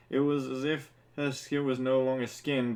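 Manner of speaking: ordinary talk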